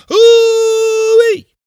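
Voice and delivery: piercing high "ooh-wee" wail; long shrieked alarm cry, very high; loud wail. A narrator's reading. Woman wailing to raise the alarm, piercing cry. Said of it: "came a different voice".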